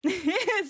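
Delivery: loud teary laugh; bright laugh. Real, not acted. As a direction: burst out laughing. Laughing after crying.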